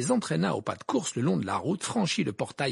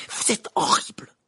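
higher, tight angry whisper; through gritted teeth; 8 semitones higher, 5 dB louder than usual. A narrator's reading. Hermione confronts Rita in the pub, through gritted teeth. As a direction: force it out through clenched teeth. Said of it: "entre ses dents serrées".

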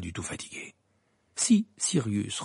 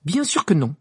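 sharp, higher and louder denial; curt Hermione; 5 semitones higher, 7 dB louder than usual. A narrator's reading. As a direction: cut him off curtly. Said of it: "répondit sèchement Hermione". (réplique sèche)

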